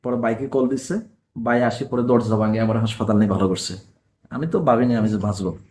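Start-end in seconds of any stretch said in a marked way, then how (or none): none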